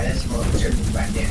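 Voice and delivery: usual speaking voice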